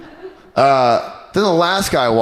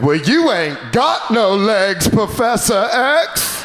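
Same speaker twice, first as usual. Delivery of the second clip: slow, higher Forrest Gump drawl; 8 semitones higher, much slower than usual. Retold or imitated. Forrest answering [name] after the run, slow drawl. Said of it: "he was like".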